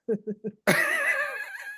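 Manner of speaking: high squealing giggle; a real high-pitched burst of laughter; real laughter after listing underwear. Real, not acted. Laughing at her own bra remark.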